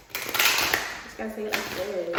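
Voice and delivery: loud, slow, drawn-out delivery; Trisha Paytas impression. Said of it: "like Trisha Paytas".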